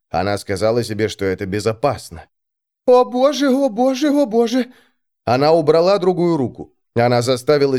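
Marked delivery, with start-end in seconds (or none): 2.9-4.7 high, frightened repeated cries; panicked. Acted. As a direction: gasp it out in panic. Alex panics before jumping.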